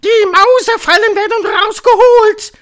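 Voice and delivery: very high, very loud roar; piercing, mighty witch bellow; thundering witch. A narrator's reading. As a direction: raise the voice with full might and thunder it. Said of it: "erhob sie die Stimme noch mächtiger denn je und donnerte".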